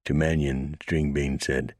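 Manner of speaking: ordinary delivery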